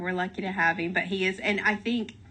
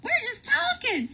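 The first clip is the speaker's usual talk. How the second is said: shrieking protest. Retold or imitated. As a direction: insist you're not yelling, squealing high. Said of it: "We're like".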